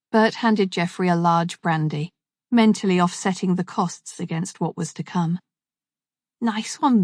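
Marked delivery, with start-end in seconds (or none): 6.4-7.0 put-on Scouse accent. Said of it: "spoke in a Scouse accent".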